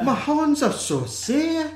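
very high, slow mock-Scottish wail; bad Scottish accent. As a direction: drag out a terrible fake Scottish accent, high-pitched. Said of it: "bad Scottish accents".

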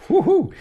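very high laughing woohoo; gleeful whoop. Real, not acted. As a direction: cheer with a laugh.

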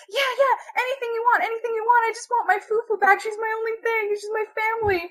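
put-on high, hurried old-lady plea; old lady, pleading. Old lady offering anything for her dog, high pleading. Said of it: "it's this old lady".

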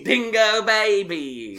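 drawn-out laughing outback cry; Australian accent. Pretend. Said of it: "Australian accent".

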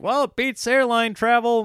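chirpy cartoon bystander, pleased with himself; high silly cartoon voice cheerfully praising the contraption; cartoon townsfolk voice. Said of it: "and people were like".

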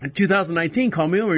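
usual speaking voice

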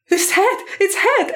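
high, slow, thrilled cry of discovery; excited scream. Retold or imitated. Mother in labour calling out to her partner, high and thrilled. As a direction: shout it high with joy. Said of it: "And then I just screamed it".

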